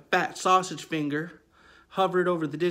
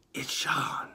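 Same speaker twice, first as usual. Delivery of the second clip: whispering caller; 2 semitones lower, 3 dB quieter than usual. Retold or imitated. Caller whispering his name to the boy on the phone. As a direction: breathe it like an eerie stranger.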